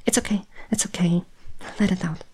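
quick, hushed comfort; soothing whisper. Acted. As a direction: whisper to calm him down. Comforting the human, who is crying.

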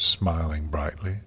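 plain reading voice

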